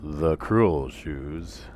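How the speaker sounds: very slow, loud, ominous; dramatic reveal. Acted. [name] revealing the cruel shoes, slow and ominous. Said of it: "I'm doing a Steve Martin bit".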